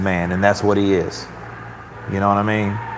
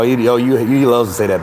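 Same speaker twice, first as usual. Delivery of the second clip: ordinary talk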